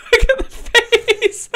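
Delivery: laughing through the words, high and slow; can barely get it out for laughing. Real, not acted. Laughing at the pickle photo she shows her friend, high and slow.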